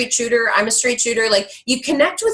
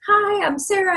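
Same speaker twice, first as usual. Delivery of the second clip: higher, eager greeting; chirpy hello; 10 semitones higher than usual. Retold or imitated. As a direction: sound bright and keen to impress. Retold nervous message to mentor.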